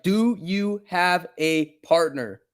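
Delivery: slow, spelled-out repeat with a falling tune; slow deliberate repeat. Retold or imitated. Streamer repeats it slowly to the paramedic, menacing. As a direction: say it again slowly, word by word, as a veiled threat.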